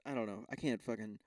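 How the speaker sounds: ordinary delivery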